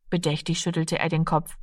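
ordinary delivery

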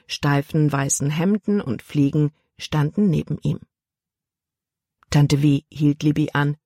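ordinary delivery

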